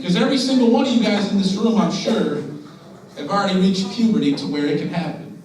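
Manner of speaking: ordinary talk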